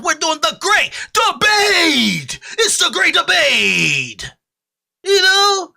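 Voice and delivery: high, loud, slow hype shout. Real, not acted. Streamer hyping the great debate, high and loud shouting. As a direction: announce it like a fight card.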